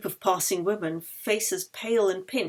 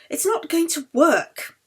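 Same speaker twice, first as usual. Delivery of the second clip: high, slow voice for Anna giving up; Anna sighs the car is hopeless; Anna, defeated; 8 semitones higher, 3 dB louder than usual. Acted. Anna tells Yeli the car won't start, resigned.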